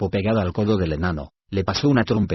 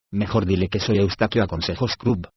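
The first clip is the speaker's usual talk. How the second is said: usual speaking voice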